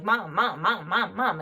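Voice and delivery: imitating kids calling Mom over and over; lightly mimicked insistent children; pestering kids. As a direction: rattle off a child's repeated calls for attention. Kids clamouring for their mother.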